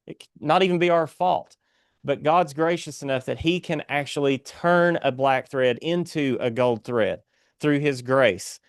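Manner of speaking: ordinary talk, nothing marked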